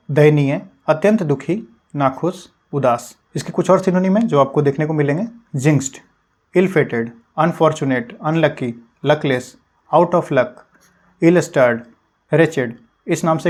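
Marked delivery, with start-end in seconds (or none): none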